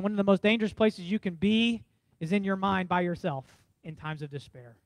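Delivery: normal talking voice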